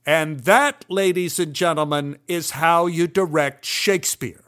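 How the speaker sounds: announcing yell. Retold or imitated. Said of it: "Milton jumped up on stage and yelled".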